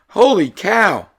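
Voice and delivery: put-on high, awestruck kid voice; amazed kid. Todd marvelling at the shoehorn the TV shows him, high and awestruck. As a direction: gasp it out like a wide-eyed boy.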